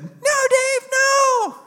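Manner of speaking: put-on high, loud little-kid cry; squeaky-high child calling out; cute child's voice. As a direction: imitate a child yelling back. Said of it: "sounds like a cute child's voice".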